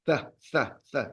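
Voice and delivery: "th" repeated as a separate soft sound; isolated th sound. Shown as a demonstration. Teacher showing the sound the Z has, isolated th. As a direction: sound out "th", tongue between the teeth. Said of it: "sound as well".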